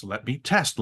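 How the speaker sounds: ordinary delivery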